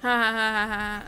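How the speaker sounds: loud burst of laughter. Real, not acted. Gamer laughing out loud at the golem.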